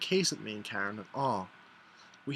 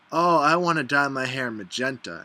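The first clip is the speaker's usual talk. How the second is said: loud, gushing mock enthusiasm; excited friend; 8 dB louder than usual. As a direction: imitate a friend announcing a wild plan, loudly. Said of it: "a friend's been like".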